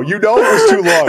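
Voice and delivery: squeaky high voice cracking with laughter; laughing, high. Real, not acted. Still laughing as he teases his co-host.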